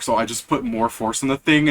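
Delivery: usual speaking voice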